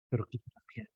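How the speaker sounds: soft whispered urging; hushed whispered urging. Acted. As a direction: whisper it like a secret plea. Hushed plea in sermon.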